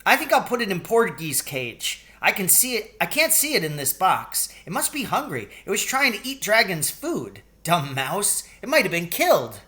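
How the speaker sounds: put-on high eager little boy chatter; excited child. A narrator's reading. Billy explaining to his mother why the cage is better, high child voice. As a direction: rattle on like an excited kid.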